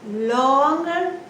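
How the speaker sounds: longer stretched out slowly; drawn-out word. Shown as a demonstration. Teacher describes a stressed syllable, stretching longer to match. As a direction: hold the first syllable of longer. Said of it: "the stressed syllable is longer".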